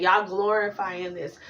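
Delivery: ordinary talk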